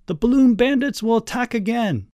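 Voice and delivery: high, playful alarm; high-pitched, urgent call; excited shout. A narrator's reading. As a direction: cry out a mock-battle warning. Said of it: "he shouted at Uncle Al".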